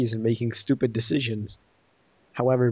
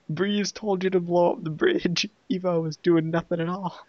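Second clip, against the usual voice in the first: higher-pitched mock outrage; incredulous protest; 6 semitones higher than usual. Reviewer objects that Breeze did the work, high incredulous protest. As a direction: protest in a raised, baffled pitch.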